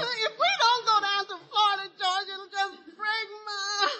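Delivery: loud, high wailing sob; fake crying. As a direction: lay the heartbreak on thick. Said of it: "I can't stand no tears".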